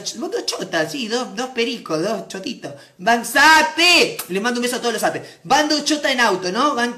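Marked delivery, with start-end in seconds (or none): 3.3-4.2 loud, higher shout; abrupt loud greeting call; sudden call-out. Real, not acted. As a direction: shout it out mid-sentence. Interrupts joke to call out.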